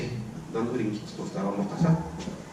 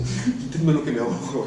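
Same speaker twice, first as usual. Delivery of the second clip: laughing mock plea; 2 semitones lower than usual. Lazarus voiced coming out wrapped.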